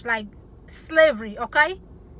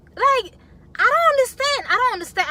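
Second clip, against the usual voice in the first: high, loud burst of real bafflement; exasperated; 11 semitones higher, 6 dB louder than usual. Real, not acted. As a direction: let exasperation push the voice right up. Real exasperated outburst about the husbands.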